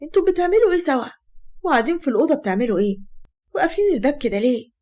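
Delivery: shocked questioning, starting high. Acted. Asmaa catches her husband with her sister, shocked. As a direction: confront them in disbelief. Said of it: "أسماء بصدمة".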